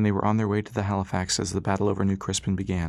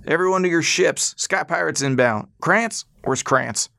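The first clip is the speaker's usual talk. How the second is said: much higher, loud commanding shout; shouting orders; 7 semitones higher, 5 dB louder, slower than usual. Acted. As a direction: bellow orders over the engines. Pop rallying crowd under attack.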